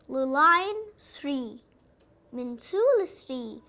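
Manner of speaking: slow. Shown as a demonstration. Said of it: "teacher tracing letter stroke slowly".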